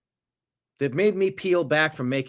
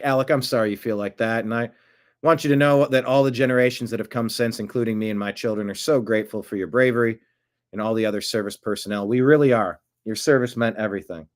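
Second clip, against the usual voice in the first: ordinary talk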